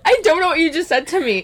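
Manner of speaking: high-pitched, helpless real laughter in the words; laughing protest. Real, not acted. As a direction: protest while cracking up. Cracking up at the nonsense word.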